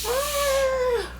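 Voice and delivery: long, high held "ah"; high, sustained cry into the wild; playful wild call. Pretend. Howls as if in the wild.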